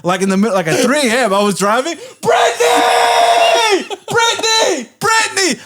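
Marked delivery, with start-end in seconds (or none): 2.2-5.6 very high, drawn-out calling scream; re-enacted screaming. Searching by car at three A M, high re-enacted screaming. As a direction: scream the name out over empty streets. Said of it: "I was literally screaming everywhere".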